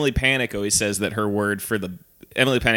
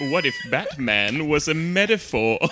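slow, higher mock-posh British; 4 semitones higher, slower than usual. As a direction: attempt a posh British accent, drawn out. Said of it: "What's the posh one?".

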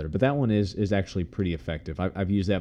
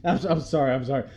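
high flustered apology between sneezes; sneezy apology; 6 semitones higher, faster than usual. Pretend. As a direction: apologize mid-sneeze, pitched up. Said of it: "I'm backing away".

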